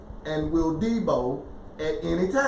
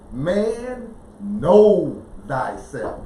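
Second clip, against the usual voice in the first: "know" drawn out slow and punched louder; emphatic KNOW; 3 dB louder, much slower than usual. Shown as a demonstration. Correcting a misread word for the listeners, emphatic stress on "know". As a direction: land hard on "know" and stretch it.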